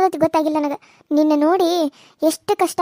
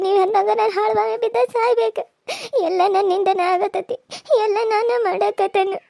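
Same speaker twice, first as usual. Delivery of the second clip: sobbing guilt; 4 semitones higher than usual. Acted. Wife to weeping husband, sobbing self-blame. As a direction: break down blaming yourself.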